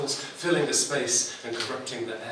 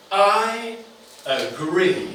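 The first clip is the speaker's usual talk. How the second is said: louder, drawn-out announcement; grand declaration; 6 semitones higher, 6 dB louder, much slower than usual. A narrator's reading. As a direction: deliver it like a huge payload. Payload answer, built up to.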